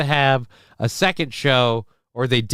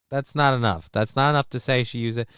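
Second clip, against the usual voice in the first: ordinary talk, nothing marked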